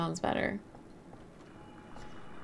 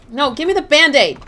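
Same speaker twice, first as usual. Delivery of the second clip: excited shout; 7 semitones higher, 11 dB louder than usual. Real, not acted. Grabbing item mid-round.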